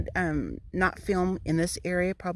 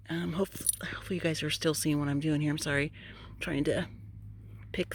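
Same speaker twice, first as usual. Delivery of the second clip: whispering; 2 semitones lower, 7 dB quieter than usual. Real, not acted. Aside while digging.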